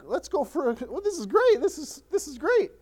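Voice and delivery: high-pitched, giddy relief; mock delight. Preacher voicing disciples after the storm calms, mock delight. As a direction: act out sudden delight, voice jumping up. Acting disciples suddenly on calm water.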